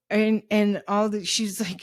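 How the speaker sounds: ordinary delivery, nothing marked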